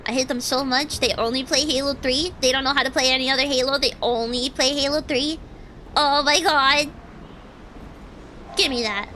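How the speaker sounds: ordinary talk, nothing marked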